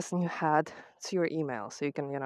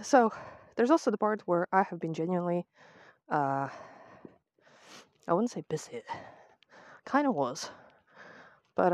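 ordinary delivery

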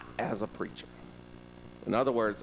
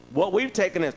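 ordinary talk

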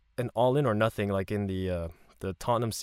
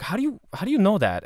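much higher, louder, disbelieving; amazed question; 11 semitones higher, 4 dB louder than usual. Retold or imitated. Surprised traveller asking locals about their player, pitched way up. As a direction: ask it like you can't believe they know.

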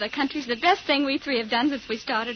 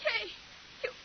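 higher, rising frantic accusation; hysterical fear; 6 semitones higher than usual. Acted. Frightened girl accusing her friend of dancing on a grave, frantic. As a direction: babble it in rising panic.

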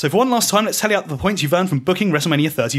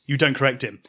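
usual speaking voice

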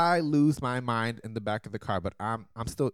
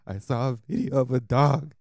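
louder, crying words through tears; sobbing retold line; 4 semitones higher, 6 dB louder than usual. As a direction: blurt it out between sobs. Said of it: "All I say is".

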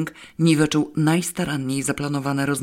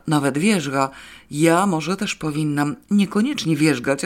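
plain reading voice

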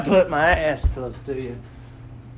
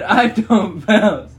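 slow, louder mocking imitation; imitating; 3 semitones higher, 7 dB louder, much slower than usual. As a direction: drag it out, mocking her. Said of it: "You just said".

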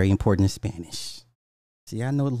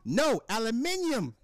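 high, slow British "aluminium"; mock British accent; 13 semitones higher, 4 dB quieter, slower than usual. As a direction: insist as a pretend Brit, slowly and high. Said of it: "imagine me telling the wife".

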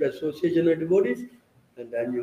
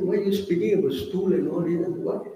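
retold objection; 3 semitones higher than usual. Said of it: "I said".

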